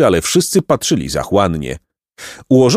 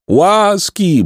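high, pleading cry; theatrical plea for mercy; begging; 10 semitones higher than usual. A narrator's reading. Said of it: "błagał Placek".

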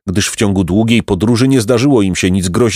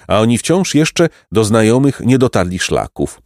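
usual speaking voice

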